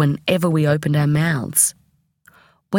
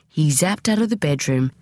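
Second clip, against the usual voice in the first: ordinary delivery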